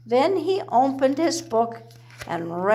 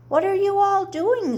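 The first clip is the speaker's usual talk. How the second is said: high, bright calling question; excited cry; 9 semitones higher, 3 dB louder than usual. A narrator's reading. School friends crying out to the chicks on the lap. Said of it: "they cried".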